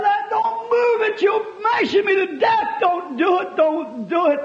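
high, slow shouted begging that eases off at the end; a pinned boy screaming at his rescuers; terrified shouted plea. Retold or imitated. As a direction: cry out in pain, don't move me.

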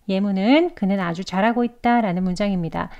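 usual speaking voice

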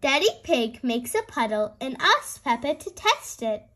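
usual speaking voice

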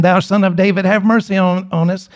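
ordinary talk

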